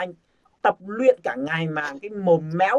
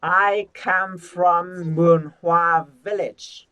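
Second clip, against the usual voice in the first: slow, joined-up model sentence; slow linked phrase; 5 dB louder, much slower than usual. Shown as a demonstration. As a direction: link every word, slowly. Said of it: "kết nối hai cái từ lại". (đọc nối cả câu thật chậm)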